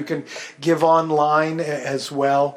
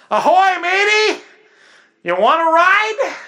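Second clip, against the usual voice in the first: high, loud hail from a ship captain; booming pirate-style greeting; hammed-up ship captain; 13 semitones higher, 6 dB louder, slower than usual. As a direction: call down from the deck like a jolly sailor. Aircraft carrier hails sinking rowboat.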